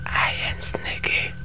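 eerie high whisper, breathy and smug; taunting whisper. Pretend. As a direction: hiss a secret threat to the enemy.

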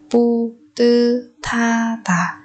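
very slow "pu tư tha tả"; syllable by syllable. Shown as a demonstration. Teacher modelling a word for learners, very slowly. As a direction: draw out each syllable of "pu tư tha tả".

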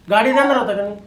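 normal talking voice

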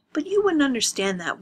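sarcastic rising jab; bitter sneer. Acted. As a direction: taunt her with contempt. Andrews turns bitter on Lena.

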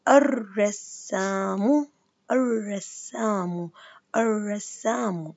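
الرسام very slowly ending -u, no l, double r; arrassamu, stretched; slow with damma ending. Shown as a demonstration. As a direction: sound out الرسامُ. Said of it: "إذا هاي شمسية".

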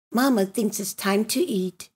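usual speaking voice

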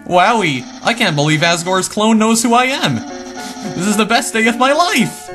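excited Papyrus. Acted. Papyrus meets Asgore's clone.

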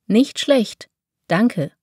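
normal talking voice

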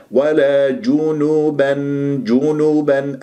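جنبا recited slowly with two pure u sounds; rounded lips. Shown as a demonstration. Teacher models the word, lips pursed. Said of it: "نضم الشفاه".

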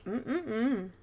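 playful savouring "mm-mm-mm"; yummy hum. Pretend. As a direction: hum as if tasting delicious cake. Savouring a yummy-looking cake.

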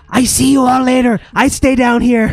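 high, loud squeaky rat prince. Acted. Rat prince waving the party off, high squeaky farewell. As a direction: pitch way up like a little rat. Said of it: "the Rat Prince says".

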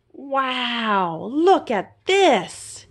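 slow, drawn-out amazement; awed wow. A narrator's reading. Mouse amazed by the museum lobby, slow awed. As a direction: let the wonder stretch slowly. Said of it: "he told his buddy".

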